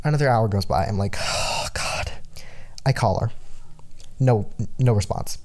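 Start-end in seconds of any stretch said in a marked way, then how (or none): 1.2-2.1 whisper. Retold or imitated. Retold despair while waiting.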